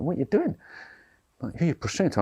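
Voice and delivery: high-pitched, baffled onlooker's question; mock puzzlement. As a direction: imitate someone taken aback. Acted onlookers puzzled by his prostrating.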